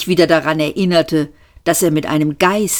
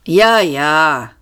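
bitter; 4 dB louder than usual. A narrator's reading. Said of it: "sagte Seyfort bitter".

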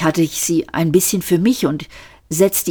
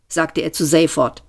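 usual speaking voice

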